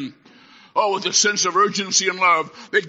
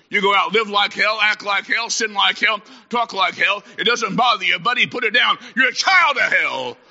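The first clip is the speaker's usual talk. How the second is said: higher-pitched, hard-driving sermon delivery; fiery preaching; 5 semitones higher than usual. Acted. Preacher rebuking the unrepentant, fiery. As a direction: hammer out each phrase like a revival preacher.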